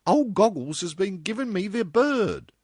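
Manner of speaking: put-on loud, very high girlish voice; hurried girl. A narrator's reading. Same girl, rushing off.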